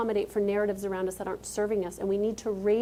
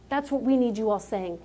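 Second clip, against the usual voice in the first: normal talking voice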